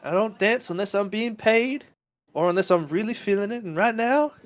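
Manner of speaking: high, playful coquettish voice; teasing dancer. Acted. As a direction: coo it like a flirt brushing him off. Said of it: "And Virginia's like".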